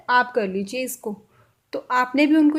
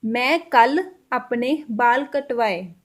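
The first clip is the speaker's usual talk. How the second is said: slow Punjabi demo; much slower than usual. Shown as a demonstration. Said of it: "इसको हम पंजाबी में कैसे बोल सकते हैं?".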